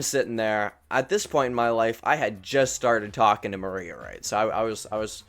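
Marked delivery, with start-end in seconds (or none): none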